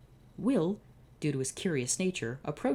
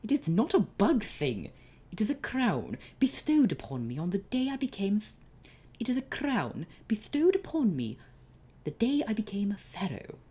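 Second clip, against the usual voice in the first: haughty pharaoh; 8 semitones higher, 3 dB louder than usual. Acted. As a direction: announce it with royal pride. Kamunra insists on his crown.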